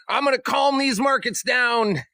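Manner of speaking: high mocking Trump voice; a hammed-up Trump quote. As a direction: raise the pitch to mock Trump. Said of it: "came out and said".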